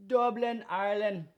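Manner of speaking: a botched Irish brogue, shouted with gusto; badly faked Irish accent, yelled for laughs. Shown as a demonstration. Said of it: "I don't know what the hell that accent was".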